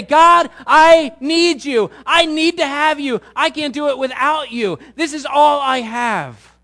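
loud, high, slow begging; pleading prayer. Acted. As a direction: beg God with everything you have. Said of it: "is to say".

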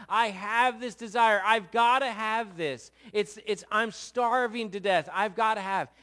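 hammed-up starving man begging; desperate craving.

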